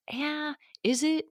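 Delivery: high, soft, doubtful tease; sceptical. Retold or imitated. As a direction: needle them with a doubting question. Mocking a self-satisfied claim.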